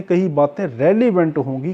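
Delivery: ordinary talk, nothing marked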